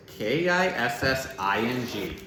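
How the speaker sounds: ordinary talk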